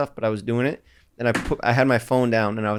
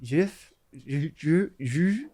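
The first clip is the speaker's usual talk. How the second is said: put-on made-up Maryland accent; 4 semitones higher, slower than usual. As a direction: mimic a made-up Southern Maryland accent, higher-pitched. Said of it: "that made up Maryland accent".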